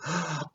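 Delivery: mock swooning gasp; mock fan gasp. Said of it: "some of you in this group that go".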